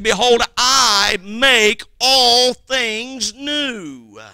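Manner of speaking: very slow, loud scripture line; slow proclamation. Acted. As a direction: stretch out every word. Quoting scripture at climax.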